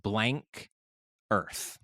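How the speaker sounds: word bleeped with a duck quack; quack censor. Real, not acted. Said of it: "Quack that out".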